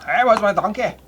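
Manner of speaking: loud Scottish ogre voice; Shrek voice. Acted. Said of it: "it's a Shrek".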